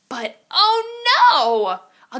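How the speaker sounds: slow, drawn-out oh no; alarmed. A narrator's reading. Storyteller reading aloud, dismayed as the hat flies off. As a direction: stretch it out in mock dismay.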